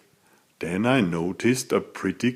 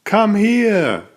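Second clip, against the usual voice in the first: raised, higher call across the cave; 8 semitones higher than usual. A narrator's reading. Narrator calls friends over to the cave, a raised call. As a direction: call them over, pitched up high. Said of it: "I called".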